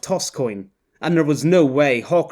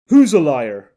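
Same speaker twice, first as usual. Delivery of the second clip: much louder, sharp Who's a liar; overheard challenge; 8 dB louder than usual. Acted. Canadian man confronting muttering Quiddell, loud challenge. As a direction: call him out loudly.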